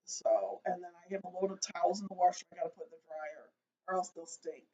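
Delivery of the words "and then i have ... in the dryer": ordinary delivery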